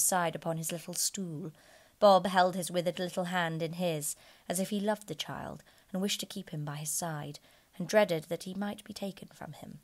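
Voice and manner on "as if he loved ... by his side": usual speaking voice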